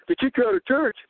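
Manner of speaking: high, incredulous echo question; surprised question. Retold or imitated. Preacher retelling a pastor's reaction, surprised.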